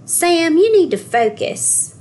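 a hint of a dad urging his son; firm dad. Dad tells Sam he needs to focus, lightly voiced firm urging. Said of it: "his dad said".

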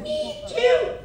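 put-on tiny, very high child voice; young kangaroo. A narrator's reading. Young kangaroo in the pouch agreeing, tiny high voice. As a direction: squeak it like a baby kangaroo. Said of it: "young kangaroo in the pouch said".

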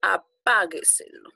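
Apágueselo said slowly, pá stressed. Shown as a demonstration. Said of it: "está la sílaba pa".

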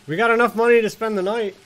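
ordinary delivery

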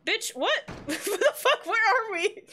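high, loud, baffled outburst; laughing disbelief. Real, not acted. Streamer suddenly lost in the game, laughing disbelief. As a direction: burst out laughing in real shock. Startled by where the game went.

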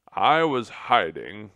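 loud, slow, aggrieved admission; miserable. A narrator's reading. Bruised general explaining himself to Tum Tum, miserable. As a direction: draw it out woefully. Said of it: "the general said miserably".

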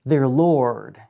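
usual speaking voice

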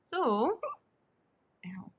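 hiccup interrupting the word; words jolted by a hiccup; suppressing hiccups. Real, not acted. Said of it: "I have the hiccups".

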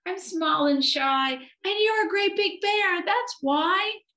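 put-on very high, timid child; scared little voice. A narrator's reading. Little voice admits fear to the bear, timidly. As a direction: pipe up high and nervous. Small shy voice answering big bear.

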